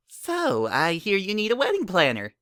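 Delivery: sly bouncy pony; high filly voice, pleased with herself; Pinkie, smug. A narrator's reading.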